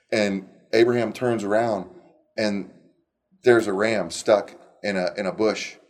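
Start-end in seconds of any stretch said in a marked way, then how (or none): none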